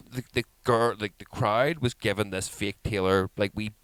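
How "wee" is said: ordinary talk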